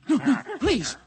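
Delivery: high, loud desperate begging; pleading as he is dragged off in terror; terrified pleading. Acted. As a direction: beg for mercy in panic.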